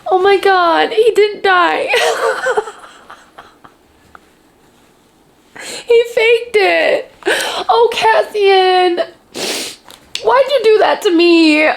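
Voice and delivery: high, slow tearful relief; crying with laughter and relief; real tearful relieved laughter. Real, not acted. Relief after fake death.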